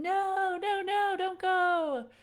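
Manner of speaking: put-on high, loud panicked child's scream of no; mock screaming child. A child clinging to mom at swim class, screaming high. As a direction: wail it like a clinging four-year-old.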